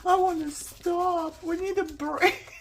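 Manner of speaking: high, loud, laughing whine; mock whining complaint. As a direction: whine like a tired kid who wants to stop. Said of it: "I find myself sitting here and saying".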